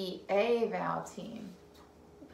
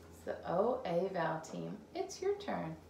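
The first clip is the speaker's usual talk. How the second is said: ordinary talk, nothing marked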